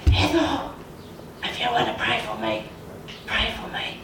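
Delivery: husky, voiceless whisper of a man without a voice; imitating a whispered request; imitated whisper. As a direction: whisper it with barely any voice. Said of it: "in all of this whispering thing".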